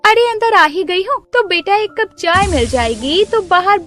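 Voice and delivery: high, eager new-neighbour voice; chatty neighbour. Acted. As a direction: sweet-talk as the pushy new neighbour. Neighbour asks for tea.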